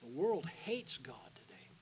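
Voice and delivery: slow, hushed whisper, no voice; solemn whisper. Real, not acted. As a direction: let it sink in with a whisper. Preacher drops to solemn whisper.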